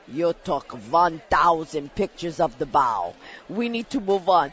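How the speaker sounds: a hint of a weary Russian pilot; Russian pilot, mild impression. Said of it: "he's going".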